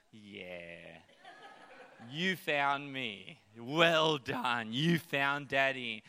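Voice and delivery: mock-impressed daddy praising a toddler; playful dad praise. Dad praising daughter who found him, lightly laughing. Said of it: "I'm like".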